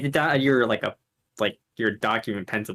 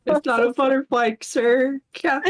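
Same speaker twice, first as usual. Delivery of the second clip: squeaky mock protest; 12 semitones higher, slower than usual. Mimics high voice.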